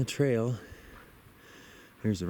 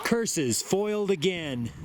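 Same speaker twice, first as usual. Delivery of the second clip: hammed-up villain, higher and slow; mustache twirling villain; 10 semitones higher, slower than usual. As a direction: drawl it like a foiled cartoon villain. Foiled by the friend's teasing.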